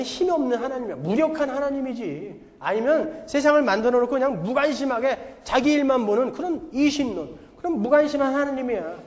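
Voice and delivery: hammed-up scornful challenge, climbing in pitch; mocking scoffer. Preacher voices the world jeering at God to the congregation, mocking. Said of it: "세상은 이렇게 조롱한다는 거예요".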